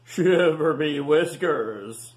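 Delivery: slow pirate first mate voice; gruff first mate. Acted. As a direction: drag it out like an old sailor. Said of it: "said the first mate".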